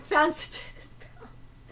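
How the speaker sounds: whispered through a giggle; hushed, half-laughing jab. Real, not acted. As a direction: breathe it as a snickering aside. Snarky aside about wasted money.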